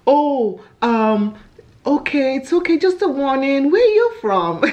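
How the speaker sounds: high-pitched imitation of a won-over cop; friendly police officer. Acting out the softened police officer.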